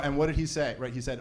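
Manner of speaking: ordinary delivery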